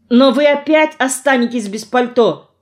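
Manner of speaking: high, insistent voice; urgent exclaiming. A narrator's reading. Two characters argue over the coat outside, urgent.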